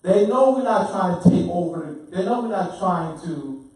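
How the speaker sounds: loud, insistent rebuttal; forceful preaching. Real, not acted. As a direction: preach it with real force at the group.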